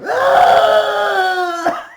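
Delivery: hammed-up high sonic scream; mock Banshee scream. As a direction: shriek it like the cartoon Banshee. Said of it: "Banshee's still going like".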